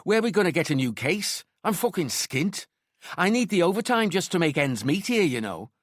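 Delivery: high-pitched Scouse complaint; Liverpudlian. A narrator's reading. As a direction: grumble in a Liverpudlian accent. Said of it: "asked in his Liverpudlian accent".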